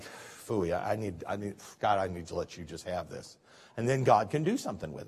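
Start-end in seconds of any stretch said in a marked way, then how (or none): 0.0-3.4 much lower and softer, spent and giving in; worn-out surrender. Retold or imitated. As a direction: sigh it out, exhausted. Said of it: "And then I'm like".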